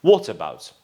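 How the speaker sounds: British "what about", O vowel, falling; British pronunciation. Shown as a demonstration. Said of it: "We have to say".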